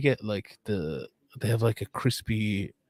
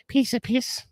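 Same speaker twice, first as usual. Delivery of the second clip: high-pitched imitation of a fighter; mimicked cheeky one-liner, pitched way up; Molly McCann; 14 semitones higher, slower than usual. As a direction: quote her in a much higher put-on voice. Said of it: "I asked her how".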